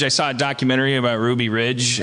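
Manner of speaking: ordinary talk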